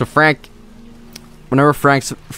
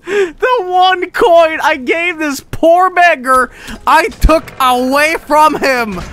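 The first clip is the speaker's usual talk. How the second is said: mock tragic lament; 3 dB louder, much slower than usual. Mock-tragic lament over beggar's coin.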